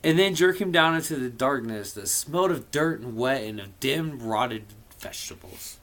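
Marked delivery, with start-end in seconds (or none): none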